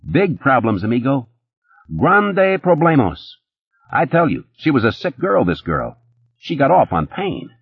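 high, fast-talking nervous hustler; Eddie's voice. A narrator's reading. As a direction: pitch it up as jittery Eddie.

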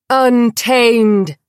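loud triumphant cheer; crowd cry. A narrator's reading. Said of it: "came the cry around the hall".

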